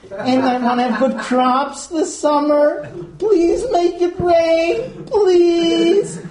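high, drawn-out mock prayer; hammed-up begging, rising on the last words; mock pleading. Pagan prays to a bottle for rain, exaggerated pleading.

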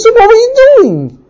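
high, loud indignant question; exasperated demand. Acted. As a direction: demand an answer, voice shooting up. Husband interrogating in acted quarrel.

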